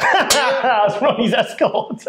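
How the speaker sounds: laughing it out, high; giggling through the words. Real, not acted. Laughing at escort joke.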